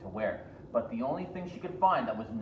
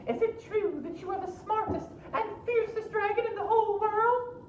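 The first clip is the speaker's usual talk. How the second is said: very high, sweet princess; high flattering princess; 4 dB louder than usual. Acted. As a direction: flatter the dragon in a high girlish voice.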